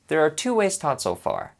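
normal talking voice

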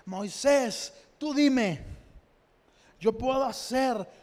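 commanding God voice. Acted. God speaks to Moses.

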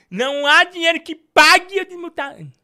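high, loud, mock-outraged declaration; loud refusal. Streamer refuses viewers' money to unmute Anne, loud. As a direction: proclaim it like a final verdict.

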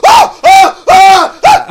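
mock priest screaming very high and loud; hammed-up high panicked screams; conniption screams. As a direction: shriek like a terrified priest. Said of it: "He starts having a fucking conniption".

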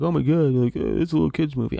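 mocking scoff. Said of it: "everyone's like".

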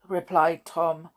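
ordinary delivery

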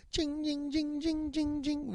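high soft jing jing like sleigh bells; jingling bells. Retold or imitated. Comic recalling holiday parade, soft high bell imitation. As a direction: ring it like little bells.

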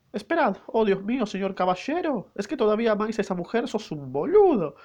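hammed-up falsetto girl catching her lover out; jealous maid voice. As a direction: gasp high, then snap the insult.

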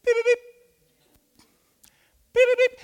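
very high, loud electronic beeping; shrill-pitched mimicked alarm beeps; door sensor beeps. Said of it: "it goes".

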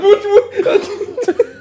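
laughing disbelief. Retold or imitated. Friend mocking the baffled student, laughing high. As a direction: squeak it out, laughing. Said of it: "You're like".

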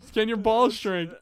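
high, laughing retelling; retelling the question through laughter; laughing repeat. Retold or imitated. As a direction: giggle the question back out. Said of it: "And my question was".

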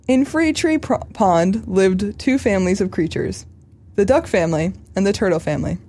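put-on earnest storybook voice for children; solemn read-aloud of a kids' tale; children's story reading. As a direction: read it like to an auditorium of kids.

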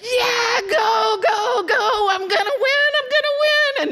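high, loud excited screaming; screaming for her horse. Retold or imitated. Bettor screaming her horse home, high and loud. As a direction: yell like your horse is winning the race. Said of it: "And I'm screaming".

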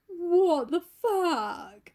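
high, very slow drawn-out shock; aghast Basil. Retold or imitated. Painter seeing the ruined portrait, aghast. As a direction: stretch every word in mock horror.

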